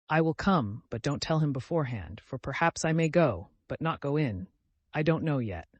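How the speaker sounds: ordinary delivery, nothing marked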